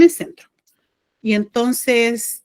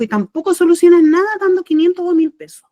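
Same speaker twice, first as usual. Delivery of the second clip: louder, higher emphatic quote from the guide; 8 semitones higher, 7 dB louder than usual. Retold or imitated. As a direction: press the point hard, like a firm spiritual guide. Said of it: "me dijo".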